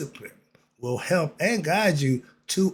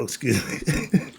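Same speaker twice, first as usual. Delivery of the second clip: ordinary delivery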